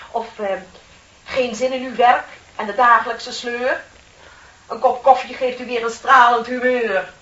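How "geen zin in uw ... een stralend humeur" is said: louder, hammed-up ad announcer rhyme; mock advertising slogan. Parodying coffee ads to her colleague, louder mock pitch. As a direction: sell the coffee like a cheesy commercial. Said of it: "die reclameteksten maken".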